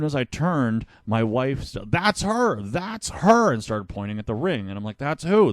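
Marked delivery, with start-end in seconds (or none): 1.9-3.5 high-pitched, urgent imitation of an excited wife; excited pointing-out. Wife alerting her husband to the woman in the ring, excited. 5.0-5.5 puzzled surprise. Retold or imitated. Retold puzzled reply to wife.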